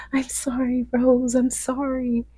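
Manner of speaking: high, drawn-out sorry; acted soothing apology. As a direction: imitate apologizing to a crying little daughter. Retold apology to crying daughter.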